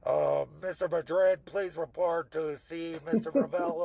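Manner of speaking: slow, formal office announcement voice; mock school intercom calling him in. School office calling a student over the intercom, formal announcement.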